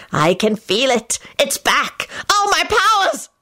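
slow, rising to a high shriek; melodramatic shriek. A narrator's reading. Scully feels his powers return, shrieking melodramatically. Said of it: "Scully shrieked melodramatically".

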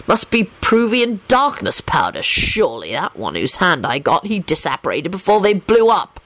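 mildly grouchy grumble; put-upon complaint; grumbling. A narrator's reading. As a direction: grumble it with rising and dropping pitch. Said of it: "he grumbled".

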